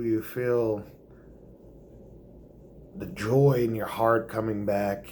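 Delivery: usual speaking voice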